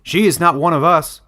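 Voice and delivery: suspicious villager. Acted. Wood collector demanding who the stranger is, loud and suspicious. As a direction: demand answers from the elders. Linich objects.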